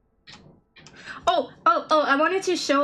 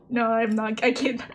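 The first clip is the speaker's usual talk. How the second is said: usual speaking voice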